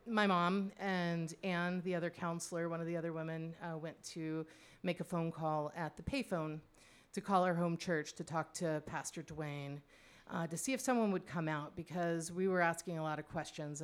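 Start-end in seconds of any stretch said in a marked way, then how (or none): none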